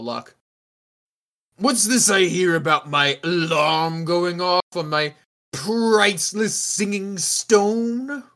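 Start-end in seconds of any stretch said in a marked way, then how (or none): 1.6-8.2 theatrical, put-on voice rising in outrage; indignant rich host. Owner confronts guests about his priceless stone, indignant. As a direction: stretch the words in affronted disbelief.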